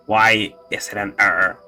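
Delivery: ordinary talk, nothing marked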